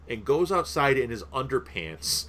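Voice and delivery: ordinary talk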